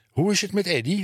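high, urgent question, as if breathless; out of breath. A narrator's reading. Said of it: "geheel buiten adem". (buiten adem, hoog en gehaast)

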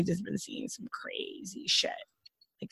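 unvoiced whisper; hushed gossip aside. Real, not acted. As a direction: drop to a secret whisper. Secretive aside.

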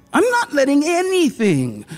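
high, strained protest; shrill cry. A narrator's reading. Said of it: "the woman shrilled".

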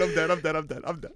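normal talking voice

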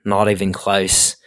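put-on slow, dismissive Aussie accent; Australian passer-by. Australian dude walking past scorns the attempt, slow Australian accent. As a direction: imitate an unimpressed Australian, drawn out. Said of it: "this Australian dude walking past".

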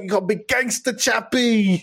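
high, hammed-up South African accent. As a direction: put on a silly South African gangster voice. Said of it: "talking with a South African accent".

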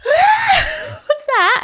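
retelling her scare with a laughing squeal; mock panicked scream. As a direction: replay the fright, squealing through a laugh. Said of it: "I screamed".

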